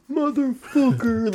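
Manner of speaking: high, drawn-out mock outrage; imitated astonished friends; amazed curse. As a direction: stretch the swear in disbelief.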